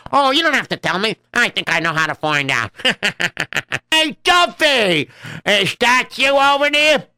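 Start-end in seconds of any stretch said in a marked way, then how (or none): 3.9-7.1 high, slow, drawn-out hail; calling out. Acted. Trader hailing Duffy across the street, high drawn-out call. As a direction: call him over from across the way.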